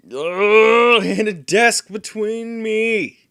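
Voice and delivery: a loud, slow, fed-up complaint; loud frustrated groan. Real, not acted. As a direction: growl it out, furious at the obstacle.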